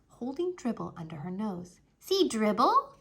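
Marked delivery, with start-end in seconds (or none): 2.0-2.9 put-on toddler voice. Toddler showing turtle.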